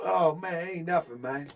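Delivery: normal talking voice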